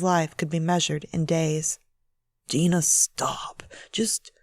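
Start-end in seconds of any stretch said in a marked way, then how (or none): 2.5-4.3 slow hushed whisper of her name; whispered plea. A narrator's reading. Husband whispering to his wife in bed, slow hushed plea. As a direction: breathe it, urging her to stop.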